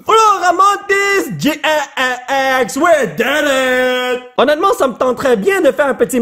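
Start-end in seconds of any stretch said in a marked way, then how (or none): 0.0-4.2 high, slow, drawn-out cheer; triumphant. Real, not acted. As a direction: savor the big pull. Carte rare trouvée, triomphe. (annonce triomphale, aiguë et lente)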